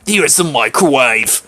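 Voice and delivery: low, slow, haughty drawl; Miranda. Acted. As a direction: draw each word out low and grandly. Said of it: "I'll do Miranda, the same voice".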